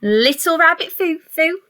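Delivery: plain reading voice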